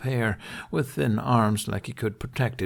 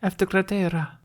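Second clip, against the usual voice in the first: curt, clipped answer, higher; clear and curt; 10 semitones higher than usual. Acted. Aide answering Hiccup, clear and curt. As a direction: shut the matter down. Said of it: "in a clear, curt voice".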